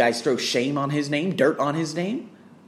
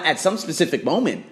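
normal talking voice

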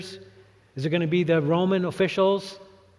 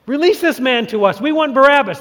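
crowd demanding; 7 semitones higher, 6 dB louder than usual. A narrator's reading. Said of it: "That's what they said".

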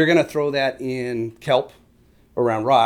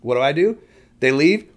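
ordinary delivery

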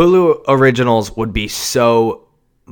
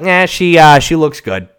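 mock studio executive; 6 semitones higher, 7 dB louder than usual. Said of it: "They were like".

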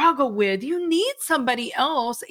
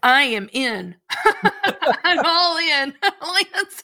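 higher-pitched, laughing through the words; real laughing; 6 semitones higher, 5 dB louder, much slower than usual. Real, not acted. Host won over by the guest's line, laughing as she agrees. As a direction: crack up while agreeing.

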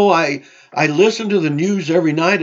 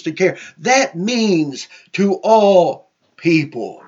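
preaching at full force; 2 semitones higher than usual. Acted. Preaching builds to climax.

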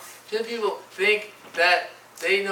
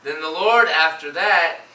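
normal talking voice

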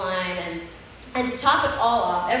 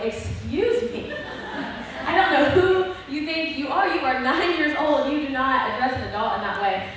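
stern mom scolding; 4 semitones higher than usual. Retold or imitated. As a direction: scold the child like an offended mother. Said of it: "and my mom was like".

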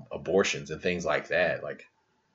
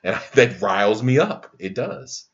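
riled up; 5 semitones higher, 7 dB louder than usual. Real, not acted. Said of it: "I'm emotional".

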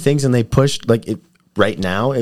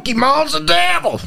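high, far-off Southern preacher voice; hammed-up Southern preacher; Southern pastor. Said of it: "Southern Pastor".

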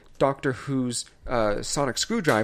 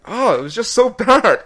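loud, high despairing groan of a verdict; exasperated; 8 semitones higher, 10 dB louder than usual. Real, not acted. Reviewer telling co-hosts about a terrible movie, loudly exasperated. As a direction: cry out in amused exasperation.

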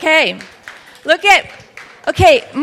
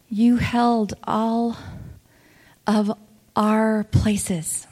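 prayer; 4 semitones lower, 4 dB quieter than usual. Acted. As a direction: pray gently, almost whispering. Slow prayerful preaching, whispered.

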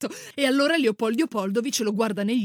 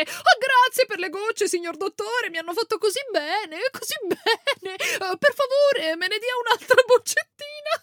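high, fawning, louder cackling voice; squawking peasant woman; 11 semitones higher, 4 dB louder than usual. Retold or imitated. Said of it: "si è messa a starnazzare".

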